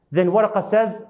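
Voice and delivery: ordinary delivery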